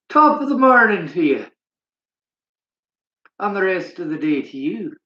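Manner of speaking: playful mock Irish accent; bad Irish accent. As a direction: try out a jokey Irish accent, badly. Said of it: "as much as my Irish one, but I try".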